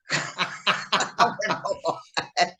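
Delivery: long run of laughter; real laugh. Real, not acted. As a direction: laugh heartily at your own anecdote. Laughing at his own anecdote.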